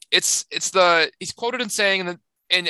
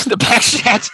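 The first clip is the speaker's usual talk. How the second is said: loud, high, cracking up; real laughing outburst; 7 semitones higher, 8 dB louder, slower than usual. Real, not acted. Co-host repeats the reader's silly backwards phrase, loud helpless laughing. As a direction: blurt it out through laughter.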